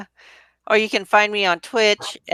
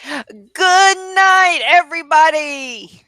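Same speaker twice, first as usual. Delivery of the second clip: loud; 8 semitones higher, 4 dB louder, slower than usual. Real, not acted. Signing off the show.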